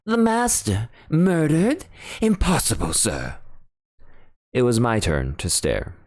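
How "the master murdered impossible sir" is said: high, breathless disbelief from the butler; gasping shock. Acted. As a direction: reel from the news. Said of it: "a gasp broke from Parker".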